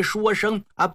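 plain reading voice

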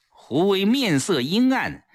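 usual speaking voice

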